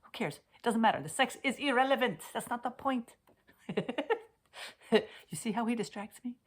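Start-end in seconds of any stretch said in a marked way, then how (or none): 0.0-3.1 retorting to Thomas. Retold or imitated. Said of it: "I'm like".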